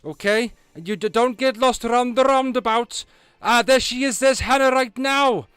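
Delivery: put-on loud, high-pitched Welsh accent; big Welsh voice à la Lee Evans. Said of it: "you want a Welsh accent".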